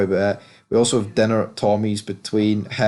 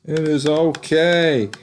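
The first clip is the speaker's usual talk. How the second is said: higher, slow put-on reassuring voice; Tommy calming Lakeisha, slow put-on reassurance; mock soothing Tommy; 5 semitones higher, slower than usual. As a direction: drag out the comfort in a put-on voice. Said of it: "Tommy's like".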